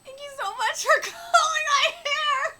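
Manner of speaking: high, loud tearful cry of thanks; joyful shout. Acted. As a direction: shout it out, overjoyed and crying. Character cries out in joy.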